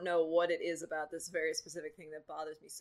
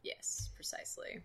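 whisper; 10 dB quieter than usual. Acted. Playful agreement bit.

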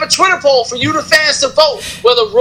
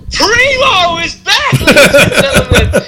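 announcer hype; 5 semitones higher than usual. Acted. Hyping a wrestler's return.

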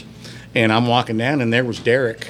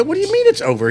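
much higher, louder incredulous question; can't believe you missed the fight; disbelief; 4 dB louder, faster than usual. Retold or imitated. Friend tells him fight ended, he protests in high disbelief.

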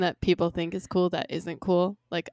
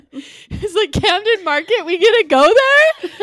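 high, loud, giddy tourist squeal; mock excited tourist; 12 semitones higher, 9 dB louder than usual. Friends mocking a wide-eyed tourist in London, high giddy squeal. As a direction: gush like a wide-eyed visitor.

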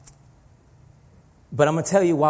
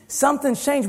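high emphatic; 11 semitones higher than usual. Shown as a demonstration. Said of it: "But something's changed".

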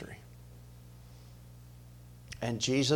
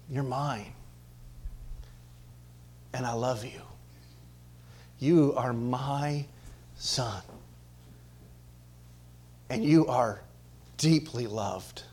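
tender, soft; 5 dB quieter, slower than usual. Acted. Voicing the Father's love to Son.

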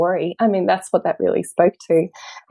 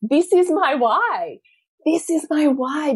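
moved realisation; 8 semitones higher, slower than usual. Retold or imitated. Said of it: "I went".

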